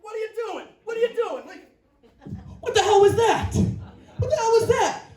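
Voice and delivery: high baffled laughing "what?"; a high, bewildered, laughing "what are you doing"; confused reaction. Retold or imitated. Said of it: "It's like".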